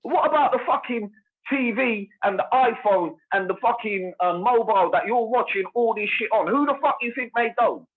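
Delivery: indignant outburst; worked-up rhetorical questions, voice climbing; getting mad. Real, not acted. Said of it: "Oh, bro, I'm getting mad".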